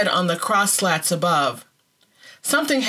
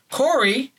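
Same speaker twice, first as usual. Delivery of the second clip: higher nudging call; prodding; 7 semitones higher than usual. A narrator's reading. Sister prods Corrie to give thanks, nudging. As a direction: nudge her to join in. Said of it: "she prodded".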